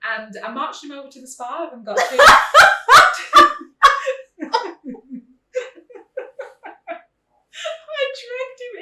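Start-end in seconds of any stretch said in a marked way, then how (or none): 2.2-5.2 loud high burst of laughter. Real, not acted. Mother cracking up while telling the teeth story.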